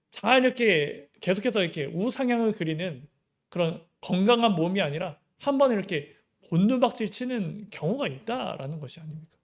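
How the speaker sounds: ordinary delivery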